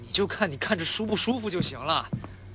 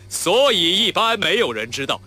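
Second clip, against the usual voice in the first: slow and louder, from the chest; chest resonance; 3 semitones higher, 7 dB louder than usual. Shown as a demonstration. Actor demonstrating chest resonance to his friend. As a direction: push the line out from your chest. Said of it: "这个是胸腔共鸣".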